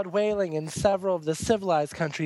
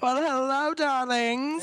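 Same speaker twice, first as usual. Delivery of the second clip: higher, theatrical diva greeting; campy drag queen; 7 semitones higher, slower than usual. Acted. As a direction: purr it like a grand drag queen. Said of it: "big silly homo, Miss Donna Sugar".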